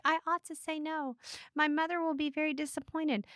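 high, soft, mock-demure voice; playing coy. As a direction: act the reluctant good girl, softly. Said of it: "she's totally just like".

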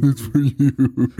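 slow, drawn-out mock demon. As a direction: beckon slowly like a playful demon. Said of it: "talking in a demon voice".

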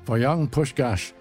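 plain reading voice

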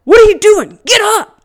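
high, loud scolding dad; imitating dad yelling. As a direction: act out an exasperated parent's shout. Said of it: "He's like".

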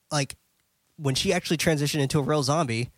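ordinary talk, nothing marked

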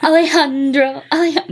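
lightly high pop star imitation; a hint of Gaga singing; [name] voice. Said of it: "In [name]'s voice?".

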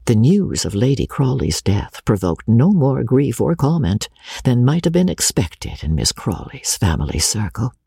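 ordinary delivery, nothing marked

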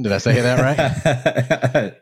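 asked through a laugh, slowly; a sheepish laughing check. Real, not acted. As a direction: chuckle sheepishly as you ask. Host asks after botching name.

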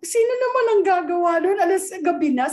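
sibling asking who would scratch the window at night; startled sibling. Retold or imitated. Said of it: "sabi ng kapatid ko". (gulat at nagtatakang tanong, mas mataas ang boses)

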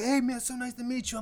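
high, gushing mock-fan voice. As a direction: imitate a starstruck fan's hello. Imitating excited fans meeting him.

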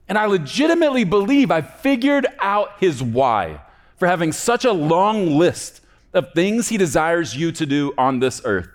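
high, loud, driving sermon delivery; impassioned preaching. Real, not acted. Preacher telling the congregation of God's desires for them, fervent. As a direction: preach it at full force, pitch up high.

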